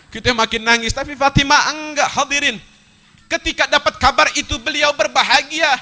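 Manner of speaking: ringing preacher's surge; impassioned preaching. Acted. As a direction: lift the voice to proclaim the turn. Preacher surges to high contrast.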